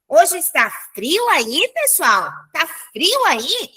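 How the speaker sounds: slow question, "frio aí" swinging up; question intonation. Shown as a demonstration. Teacher showing students how a question sounds, questioning intonation. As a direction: ask it with a drawn-out, questioning lilt on "frio aí". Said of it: "essa entonação é uma entonação de pergunta".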